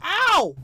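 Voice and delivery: high yelp then gasp; pained yelp and gasp. Real, not acted. Pain cries in game.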